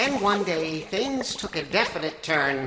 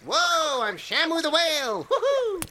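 lightly voiced whale, higher; 12 semitones higher than usual. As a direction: play a happy whale. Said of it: "my Shamu the Whale impression".

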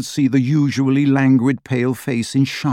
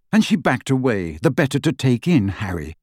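ordinary delivery, nothing marked

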